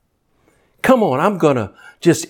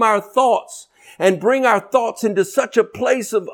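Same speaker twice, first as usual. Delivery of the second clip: preaching at full force; 11 semitones higher than usual. Acted. Preacher stirring the church, full-force preaching. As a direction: whoop it up to the congregation.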